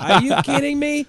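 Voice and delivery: high, incredulous cry; real disbelief. Real, not acted. Host hearing the prank story, high shocked cry. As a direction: react in genuine shock.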